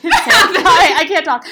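ordinary talk

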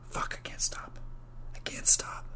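unvoiced whisper to himself. Podcaster who can't stop thinking up haircuts, whispering. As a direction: whisper it as a helpless confession. Mock helplessness at his own rambling.

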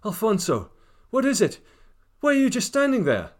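high, loud anguished call; loud high desperate shout. Acted. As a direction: cry out to her lover across the hall. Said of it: "she shouted".

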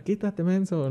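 high-pitched, softened pretend shout; half-voiced yell from behind the wheel; mock driver's yell. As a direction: imitate heckling a driver, volume held down. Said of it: "les puedo gritar".

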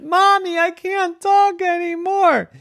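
put-on very high child's wail; distressed little girl. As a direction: squeal it to your mother in acted panic. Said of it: "which meant".

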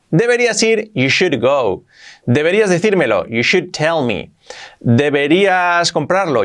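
normal talking voice

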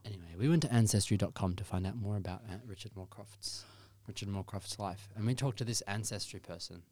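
usual speaking voice

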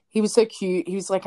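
ordinary delivery

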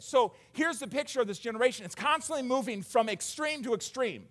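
ordinary talk

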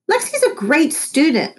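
high, sweet teacher voice; Miss Corgi. A narrator's reading. Teacher praising Lexi to Noah, high sweet voice. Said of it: "Miss Corgi added".

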